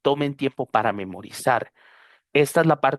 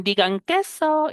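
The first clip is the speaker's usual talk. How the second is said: high, slow "Digan queso"; photo cue; 13 semitones higher, slower than usual. Retold or imitated. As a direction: coax everyone to smile for a photo. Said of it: "y dicen". (fotógrafo pide sonreír, agudo y lento)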